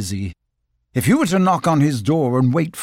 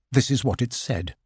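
plain reading voice

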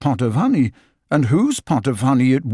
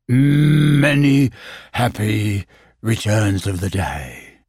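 gloomy Eeyore; 3 semitones lower, slower than usual. Acted. Eeyore voiced in story.

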